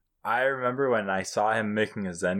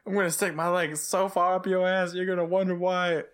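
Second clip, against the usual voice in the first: higher-pitched mock menacing threat; mock tough-guy threat; 9 semitones higher than usual. Friend retelling a show's threat, mock menacing and higher. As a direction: ham up the cowboy threat, voice pitched up. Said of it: "I was just like".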